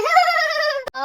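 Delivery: loud, high burst of mocking laughter. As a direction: laugh at her choice. Others mock the princess.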